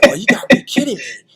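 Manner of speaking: high laughing delight; laughing disbelief. Real, not acted. Telling the host his release, laughing in disbelief. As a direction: laugh it out in happy shock.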